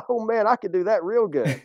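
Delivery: high-pitched laughing mock of cocky guys. Imitating guys who scoff at doing nothing, high and laughing. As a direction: laugh it off like a guy who thinks it's easy. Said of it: "a lot of guys laugh at that and they go".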